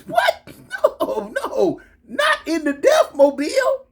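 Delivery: squeaky, laughing disbelief; scandalized comic protest; hammed-up horror. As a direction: jump way up in mock horror. Hammed-up horror over his car.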